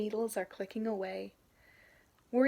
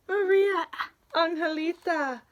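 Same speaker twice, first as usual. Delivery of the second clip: calling out; 7 semitones higher, 8 dB louder than usual. Acted. Grandmother calls the children.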